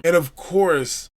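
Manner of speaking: ordinary talk, nothing marked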